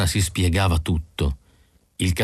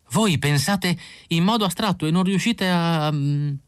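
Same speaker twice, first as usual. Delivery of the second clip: much higher, faltering Isidor; words catching in his throat; 9 semitones higher than usual. A narrator's reading. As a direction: reach for words and get stuck, pitched up. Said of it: "le parole gli si impigliavano in gola". (Isidor esita, le parole gli si bloccano in gola)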